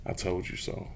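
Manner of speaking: whispered I told you so, no voice; calm whisper. Shown as a demonstration. To Bears fans, calmly whispering. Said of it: "real calm and just keep saying".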